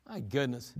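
ordinary delivery

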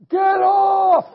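high, drawn-out full-force yell; agonized holler at the pile-up. Retold or imitated. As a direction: yell it long and loud to get them off. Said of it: "I'm yelling".

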